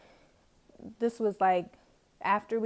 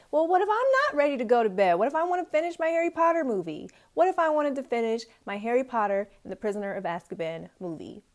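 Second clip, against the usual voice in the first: loud, high, rapid-fire protest; indignant retort; 5 semitones higher, 7 dB louder than usual. Retold or imitated. Student arguing to finish her movie, indignant outburst. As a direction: fire back in indignation, pitched up. Ranting back at the RA's rule.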